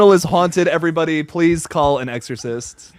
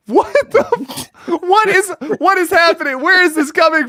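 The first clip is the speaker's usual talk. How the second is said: high-pitched disbelief; 12 semitones higher than usual. Real, not acted. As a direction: voice jumps way up in shock. Reacting to spooky moment.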